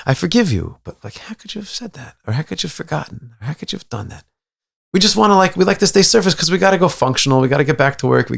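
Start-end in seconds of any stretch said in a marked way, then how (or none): 0.1-4.2 soft hurt questioning; hushed reproach. Retold or imitated. Hurt partner questioning the apology, soft reproach. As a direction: ask quietly how they could. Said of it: "what's going on".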